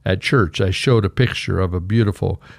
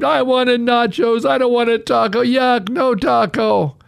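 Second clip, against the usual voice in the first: put-on much higher, slow whining child tantrum; whiny kid scream; 13 semitones higher than usual. Spoiled son whining at his mother, high tantrum. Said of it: "he screamed".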